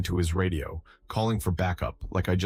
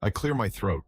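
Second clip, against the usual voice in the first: plain reading voice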